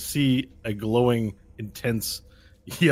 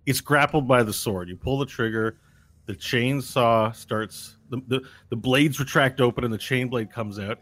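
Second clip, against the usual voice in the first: ordinary talk, nothing marked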